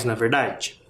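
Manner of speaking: normal talking voice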